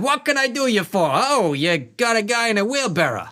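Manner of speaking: high, fast chatty cabbie voice; a breezy water-taxi driver shooting the breeze; chatty cabbie Jerome. Acted.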